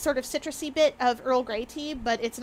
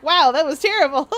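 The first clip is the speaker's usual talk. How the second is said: mock disgust; 4 semitones higher, 9 dB louder than usual. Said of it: "you're like".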